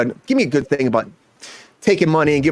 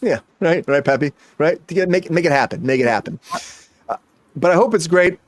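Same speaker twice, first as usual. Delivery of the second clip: ordinary delivery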